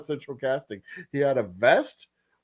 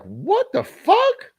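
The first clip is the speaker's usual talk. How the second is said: much higher, drawn-out what the fuck; shocked shriek; 4 dB louder, much slower than usual. Retold or imitated. Waitress Janet reacting as the glass door explodes, shrieking slowly. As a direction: squeal it slowly in disbelief. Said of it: "Janet was like".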